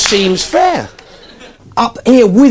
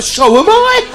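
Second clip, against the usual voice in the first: very high, delighted cry; gleeful shout; 14 semitones higher, slower than usual. Pretend. Comic matching the caller's pitch, gleefully high. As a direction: pounce with mad glee. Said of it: "get fucking weird".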